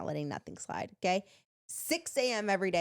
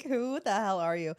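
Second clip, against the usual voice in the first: mock disbelief; 4 dB louder than usual. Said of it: "I'm like".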